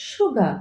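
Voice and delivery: "সুগা" with silent final r; correct pronunciation. Shown as a demonstration. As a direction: end সুগা on an open a. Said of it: "correct pronunciation হবে". ("সুগা" শেষে র ছাড়া শুদ্ধ উচ্চারণ)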